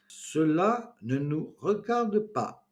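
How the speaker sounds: very slow, each syllable separated and clipped apart. A narrator's reading. Edith primly scolding the laughing room, slow separated syllables. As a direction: spell it out one syllable at a time, prim. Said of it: "en distinguant bien chaque syllabe".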